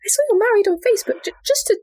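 high, tentative mum voice; mock-casual motherly inquiry, high and halting; imitated mum. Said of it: "my mum still texted me like".

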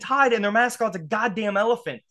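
high-pitched, indignant fan rant; fired up. Real, not acted. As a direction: argue it like a riled-up supporter. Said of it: "I'm fired up".

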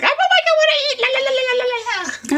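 put-on very high singsong baby voice. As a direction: sing it like a fussy baby. Baby refusing to eat, voiced.

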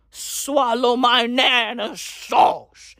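very slow put-on shouting voice; hammed-up shout. Comic shouting his crude punchline in a silly slow voice.

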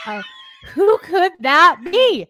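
theatrical fake mystery; mock suspense. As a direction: play dumb, drawing out the question. Feigned suspense over the guest.

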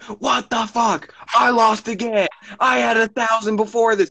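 high-pitched imitation of a ranting loser; hammed-up outrage, pitched well up; mock yelling. Friend imitating the other's casino meltdown, mock yelling. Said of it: "You were yelling at me".

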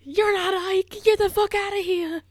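put-on loud, high Boston-accented yell. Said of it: "You think she has a Boston accent?".

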